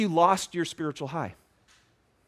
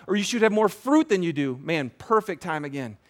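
usual speaking voice

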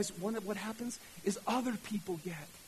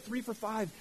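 ordinary delivery

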